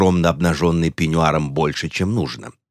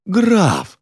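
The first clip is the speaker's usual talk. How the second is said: very high, wailing "Граф"; shocked cry; 12 semitones higher than usual. A narrator's reading. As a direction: cry out in offended horror. Insulted countess exclaims.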